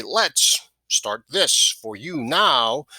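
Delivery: very slow, "Let's" set apart by a pause, weight on "now"; trial phrasing. Shown as a demonstration. Sales trainer trying out ways to say the phrase, slow and deliberate. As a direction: draw out "Let's start this for you now" word by word. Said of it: "However it works in your voice".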